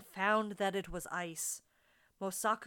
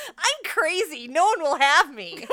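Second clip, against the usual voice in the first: loud, high, laughing wail; 7 semitones higher, 13 dB louder than usual. Acted. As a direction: cackle the words out. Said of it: "answered laughingly".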